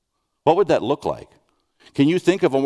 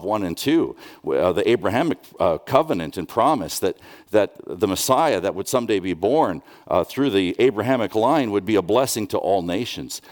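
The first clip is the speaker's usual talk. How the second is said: normal talking voice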